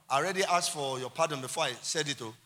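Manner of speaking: normal talking voice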